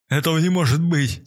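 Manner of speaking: high frantic yell; a homeless man yelling in disbelief. A narrator's reading. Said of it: "вопил бомж".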